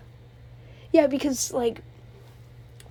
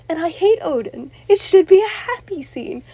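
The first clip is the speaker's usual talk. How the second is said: higher, louder, emotional outburst; near tears; 5 semitones higher, 10 dB louder than usual. Real, not acted. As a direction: voice straining on the edge of crying. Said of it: "Loki just makes me cry".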